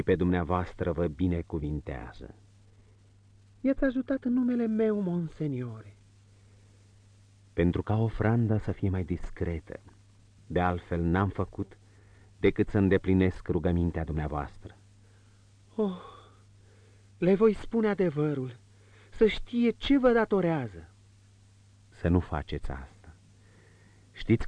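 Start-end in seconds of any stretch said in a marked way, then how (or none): 3.6-6.0 higher, lighter woman's voice, confiding; marchioness's voice. Acted. Marchioness confiding to the prince, in a higher woman's voice. 15.7-20.9 higher, lighter woman's voice, confiding; marchioness's voice. Acted. Marchioness confiding to the prince, in a higher woman's voice.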